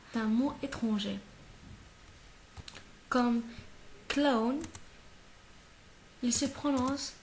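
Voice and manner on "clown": CLOWN said the English way, "klown"; teacher's model "clown", as in English; English pronunciation. Shown as a demonstration. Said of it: "comme l'anglais".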